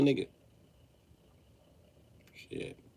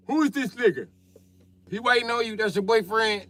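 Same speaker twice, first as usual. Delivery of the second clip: suspicious dad; 12 semitones higher than usual. Retold or imitated.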